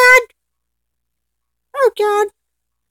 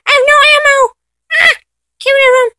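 ordinary talk

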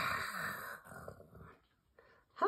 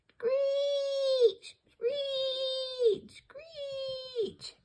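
very high drawn-out screeches; bat screeches; much slower than usual. Shown as a demonstration. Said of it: "bats screech".

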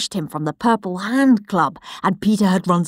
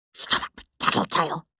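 softer, breathy hiss; 3 semitones lower, 6 dB quieter than usual. Acted. Henry silencing his brother, hissing. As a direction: spit it low and voiceless. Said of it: "hissed Henry".

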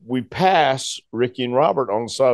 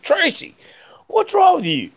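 much higher, pleading disbelief; incredulous. Retold or imitated. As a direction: squeak it out, baffled by him. Confronting the raging Tracy.

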